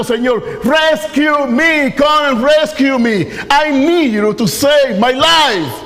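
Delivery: high, loud cry to God, slowed; shouting prayer. Acted. Pastor voicing his own cry to the Lord, a loud pleading shout. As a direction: cry out for rescue with everything. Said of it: "Estoy gritando".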